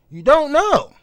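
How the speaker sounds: high, loud outburst of disbelief; exasperated yell of you don't know; emphatic shout. Real, not acted. Ranting about life out here.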